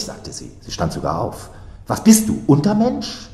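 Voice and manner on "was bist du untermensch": high, affronted outburst; loud start, voice jumping up in outrage; indignant. A narrator's reading. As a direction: recoil with a pitched-up insult. Acted quarrel, recoiling from his touch.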